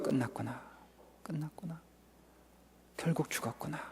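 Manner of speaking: hushed, hopeless inner voice, very soft; despairing whisper. Acted. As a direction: let it die away in despair. 낙심한 야이로의 속마음. (절망한 속삭임)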